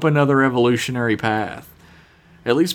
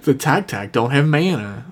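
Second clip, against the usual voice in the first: normal talking voice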